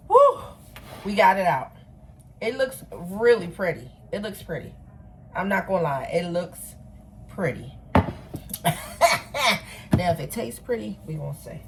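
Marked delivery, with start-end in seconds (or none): 0.1-0.4 short, loud, high-pitched whew; relieved whoop. Real, not acted. Relief after freeing the cake. 7.8-9.9 airy burst of laughter; amused laugh. Real, not acted. Laughs at her pretty cake.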